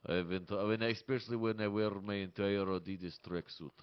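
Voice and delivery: deadpan comic Russian accent. Shown as a demonstration. Comic describing his Slavic look, staying in Russian accent. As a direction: stay in a dry Russian-accented character. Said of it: "Can you do a Russian accent?".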